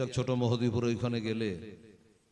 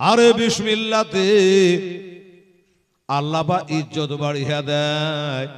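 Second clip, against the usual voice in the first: preaching cry; 13 dB louder, slower than usual. Acted. Preacher praising Bismillah to the crowd, sung. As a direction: cry it out then let it sing.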